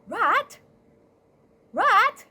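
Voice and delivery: high, loud searching call. Acted. Cat checking on Rat at home, calling out. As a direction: call through the house for a friend.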